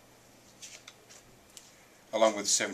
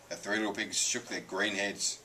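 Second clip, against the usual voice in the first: ordinary delivery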